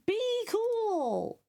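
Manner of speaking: ordinary talk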